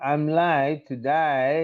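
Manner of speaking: American-accented "late today" sounding "like to die"; very slow American vowels, "die" for "day". Shown as a demonstration. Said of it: "is pronounced in American accent". (American యాసలో నెమ్మదిగా "లైట్ టు డై")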